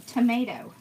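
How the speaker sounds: ordinary talk, nothing marked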